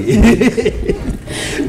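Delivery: high, hearty chuckle; amused laugh. Real, not acted. Host laughing after teasing the guest, high amused laugh. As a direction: burst out laughing at your own tease.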